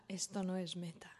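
whispering. Retold or imitated. Walter's quote, retold in a hushed voice.

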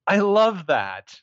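a slow, goofy, mock-earnest reply. Mocking the hero's goofy reply to her, slow and goofy. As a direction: stretch it out like a lovestruck dork. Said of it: "super fucking goofy".

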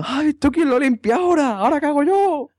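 high, shrill-pitched outraged yell in a put-on driver's voice; taxi driver yelling. Taxi driver shouting at the drunk passenger who threw up, high outraged yell. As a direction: squeal it out like a furious cabbie whose car just got soiled. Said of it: "Y el taxista gritándome".

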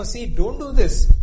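loud, slow coaxing plea; convincing plea. Retold or imitated. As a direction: Tamar coaxing her brother. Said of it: "She's trying to say, okay, convince him".

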